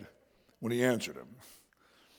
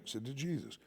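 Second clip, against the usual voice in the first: ordinary delivery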